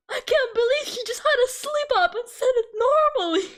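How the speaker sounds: high-pitched retold incredulity; amused disbelief. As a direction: squeal it in mock disbelief, near laughter. Retelling own disbelief, near laughter.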